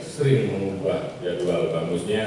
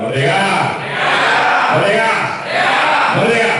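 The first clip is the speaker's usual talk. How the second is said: loud shouted battle cry, rising each time; shouted Merdeka; 12 semitones higher, 10 dB louder than usual. Real, not acted. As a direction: shout it out with full force. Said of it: "wajib kita teriakkan salam perjuangan kita".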